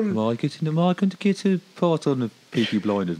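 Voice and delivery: high Birmingham accent, showing it off; playful Brummie accent. Shown as a demonstration. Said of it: "My Brummie accent's quite good".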